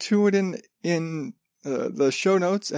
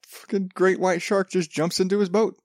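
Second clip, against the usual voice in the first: usual speaking voice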